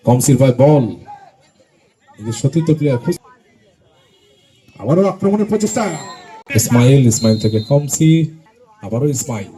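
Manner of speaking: loud rapid play-by-play; frantic football commentary. Real, not acted. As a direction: call each pass loudly. Live play-by-play. (উত্তেজিত football ধারাভাষ্য)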